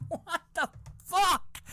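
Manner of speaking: high, slow, laughing squeal; helpless laughter, utterly baffled; laughing disbelief. Real, not acted. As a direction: squeeze the words out through laughter. Laughing helplessly at newsman's punchline.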